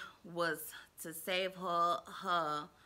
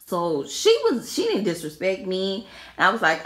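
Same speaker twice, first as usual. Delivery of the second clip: loud excited telling; 13 dB louder than usual. Real, not acted. Excited storytelling.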